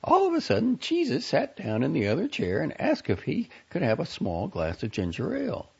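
put-on high, fretful young woman complaining; a high-pitched, exasperated young woman; Franny's voice. A narrator's reading. Franny's worried voice, read aloud.

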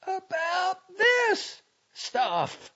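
high, loud, slow angry outburst. A narrator's reading. Girl angrily repeating herself before hurling the book, angry. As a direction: throw each word out loud and furious. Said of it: "she repeated angrily".

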